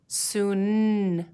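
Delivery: "Soon" with a long, held N; final sound drawn out. Shown as a demonstration. Teacher helping pupils hear the last letter, final sound drawn out. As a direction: stretch the end of "Soon" into a hum. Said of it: "What letter is at the end".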